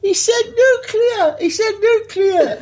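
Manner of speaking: squealing high through laughter, slowed; gasping it out while helpless with laughter; hysterical laughing. Retold or imitated. Retelling losing it watching the film, high laughing squeal. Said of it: "I'm just going".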